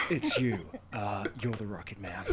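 teasing through laughter; giggling the words out; laughing. Real, not acted.

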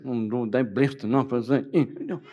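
half-joking, low-pitched protest; grudging refusal. Retold or imitated. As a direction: act out balking at God's nudge, lightly and with humour. Acts out reluctance to obey God.

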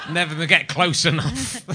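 slow, laughing through the words; laughing punchline. Real, not acted. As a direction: chuckle it out slowly. Laughing at own joke.

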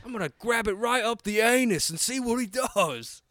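high, far-off mock Australian croc hunter voice; mock Australian accent. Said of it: "Your accent evolved".

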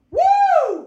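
very high, drawn-out "woo"; delighted whoop. Retold or imitated. Comic imitates the fan whooping instead of laughing, high delighted whoop. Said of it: "he's just going".

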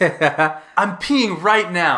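comic high yell, drawn out slowly; loud outraged protest. Retold or imitated. Man caught mid-stream protesting to the intruder, mock-outraged yell. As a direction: yell it indignantly, half laughing, voice way up.

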